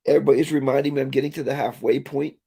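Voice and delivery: usual speaking voice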